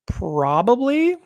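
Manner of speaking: slow, high drawn-out probably; drawn-out knowing. Real, not acted. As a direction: stretch it out knowingly. Sarcastic knowing reply.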